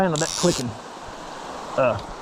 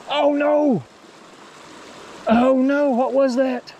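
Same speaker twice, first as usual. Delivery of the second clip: dismayed cry; 7 semitones higher, much slower than usual. Real, not acted. Fish got away.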